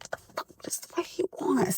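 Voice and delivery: hushed, breathy muttered curse; whispered aside. Retold or imitated. Woman seeing ex keep calling, whispering to herself. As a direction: whisper it to yourself in disbelief. Her reaction to repeated calls.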